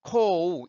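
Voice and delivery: "코우" loud, drawn out into a full "우"; wrong L as 우. Shown as a demonstration. Teacher shows what is not meant, overdrawn "코우". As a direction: exaggerate the vowel glide in "코우". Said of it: "이게 아니고요".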